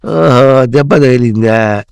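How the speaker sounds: loud, drawn-out, shrugging off hurt; slow, emphatic demonstration of letting it go; unbothered acceptance. Shown as a demonstration. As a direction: model not minding a knock. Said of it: "అను".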